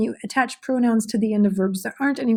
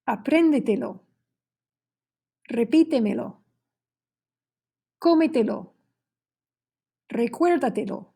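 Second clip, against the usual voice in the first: teacher's word list, pausing after each, accent early as in GUÁR of "Guárdamelo"; stress fourth-from-last syllable; 2 semitones higher than usual. Shown as a demonstration. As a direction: model each word alone, punching the fourth-last syllable, as in CUÉN in "Cuéntamelo". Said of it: "falls on the fourth syllable from the end".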